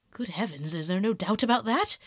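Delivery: mildly voiced shock; understated horrified exclamation; crying out. A narrator's reading. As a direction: ask in dismay, rising through the question. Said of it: "cried Howard".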